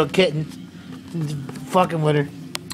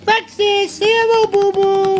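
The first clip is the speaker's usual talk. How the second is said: much higher, louder pet talk; cooing at a kitten; 12 semitones higher, 5 dB louder than usual. Real, not acted. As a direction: call to the cat in a high sing-song. Cooing to Alexis the cat.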